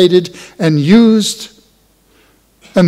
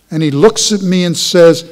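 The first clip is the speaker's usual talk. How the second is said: ordinary talk, nothing marked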